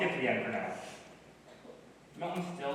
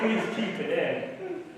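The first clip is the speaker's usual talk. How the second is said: laughing; 6 semitones higher, 6 dB louder, much slower than usual. Real, not acted. Laughing after joke.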